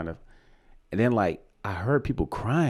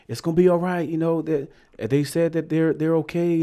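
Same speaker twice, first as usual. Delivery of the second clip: higher-pitched retold reassurance; imitated reassurer; 8 semitones higher, 3 dB louder than usual. Storyteller voicing airport worker comforting the family, imitated reassurance. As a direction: quote the comforter in a raised voice. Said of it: "when the dude was like".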